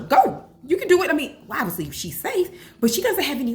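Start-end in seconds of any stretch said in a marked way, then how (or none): 0.0-1.1 high, louder cheering-on; re-enacted pep talk, pitched up; encouraging. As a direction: urge a little one onward. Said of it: "I encourage her".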